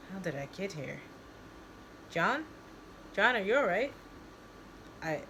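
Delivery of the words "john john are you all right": higher-pitched, concerned check-in; concerned questioning. Acted. Someone checking on dazed John, concerned questioning. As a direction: call his name, then check on him.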